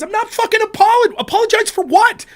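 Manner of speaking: loud, high indignant rant; refusing her demand to apologize, loud and high; angry outburst. Real, not acted. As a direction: blow up in disbelief.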